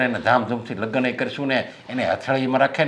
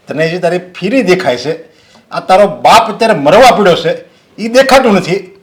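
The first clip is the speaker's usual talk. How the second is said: higher and louder, yelling at his daughter; furious shouting; 11 semitones higher, 14 dB louder than usual. Acted. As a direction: blast her with rage and blame. Father yells at daughter about fees.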